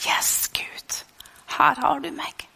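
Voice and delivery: whispery, soft surrender to God; hushed prayer. Acted. Preacher modelling a decision to say yes to God, hushed. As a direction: pray it under the breath, softly.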